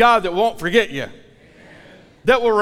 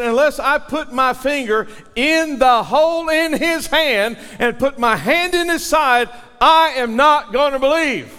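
higher and louder, insisting hard; defiant demand; 7 semitones higher, 4 dB louder than usual. Acted. Doubting Thomas insisting on proof before he believes, loud. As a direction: push it out with stubborn defiance.

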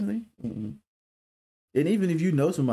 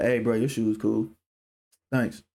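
low-key, lower-pitched delivery; nonchalant compliment; 5 semitones lower than usual. Acted. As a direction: toss it off without caring. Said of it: "like, nonchalant type shit".

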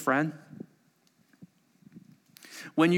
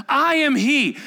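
I am he, pitched much higher and louder, ringing out; bold declaration; 10 semitones higher, 6 dB louder, slower than usual. Acted. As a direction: proclaim it so the crowd falls back. Jesus declares himself to the soldiers.

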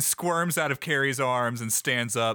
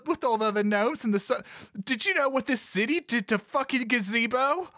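higher-pitched agitated gnome, building in intensity; increasingly worked-up accusation; upset, confronting; 6 semitones higher than usual. Acted. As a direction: protest to your mother, pitch climbing as you go. Garrett confronts his mother, upset.

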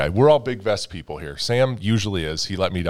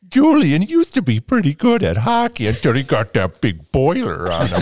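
Bubbles impression; 4 semitones higher than usual. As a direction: do Bubbles from Trailer Park Boys. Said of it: "I wanna do a Bubbles impression".

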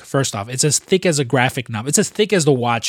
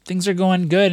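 much higher, breezy owner voice; mock owner shrugging off trouble; carefree owner; 7 semitones higher, slower than usual. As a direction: wave it off, all is fine. Owner brushing off the chef.

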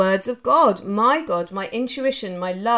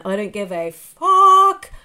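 emphatic; 7 dB louder, slower than usual. Real, not acted. Emphatic aside.

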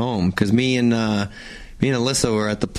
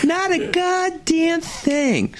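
very high, drawling mock voice; mimicked punchline; much slower than usual. Host milking the riddle punchline once more, squeaky drawn-out mimicry. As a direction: squeal it out as slowly as possible. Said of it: "and you go".

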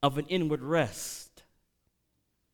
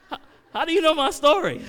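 much higher and louder, playful astonishment; mock surprise; 10 dB louder than usual. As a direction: act amazed they know it. Preacher feigns amazement at congregation.

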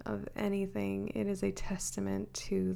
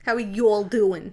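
louder, laughing Southern greeting in a Brooklyn accent; 9 dB louder than usual. Shown as a demonstration. For the host, laughing, a Southern greeting in a Brooklyn accent. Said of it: "talk like a Southerner with a Brooklyn accent".

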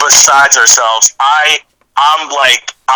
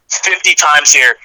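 ordinary talk

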